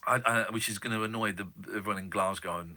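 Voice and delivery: ordinary delivery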